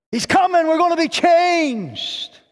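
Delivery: high, ringing joy at the good news; exultant cry. Real, not acted. Preacher tells the church the King is coming, jubilant. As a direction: lift it up like a shout of praise.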